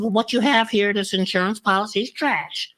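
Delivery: imitating the lady. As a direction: play the frank bank lady up high. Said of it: "She said".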